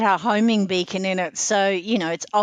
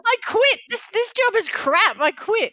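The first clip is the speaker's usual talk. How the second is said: mock quitting. Fed-up worker quits, mock.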